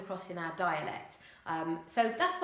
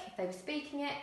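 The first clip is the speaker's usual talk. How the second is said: ordinary delivery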